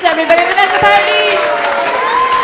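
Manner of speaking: loud, high host intro over the crowd; booming emcee introduction; announcer intro. Real, not acted. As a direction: hype up the next act. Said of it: "host introducing the act".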